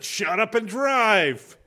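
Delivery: high, loud, drawn-out yell; exasperated yelled order. Retold or imitated. Passenger to the driver who didn't say anything, exasperated yell. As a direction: shout it down, stretching the last word. Yells at driver protesting innocence.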